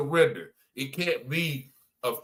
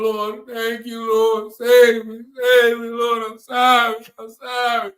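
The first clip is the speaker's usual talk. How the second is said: high, loud, tearful pleading prayer; broken-down altar plea, voice pitched up and straining; crying out to the Lord; 9 semitones higher, 7 dB louder than usual. Retold or imitated. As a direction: beg the Lord through tears, slow and high. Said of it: "I start crying and snotting".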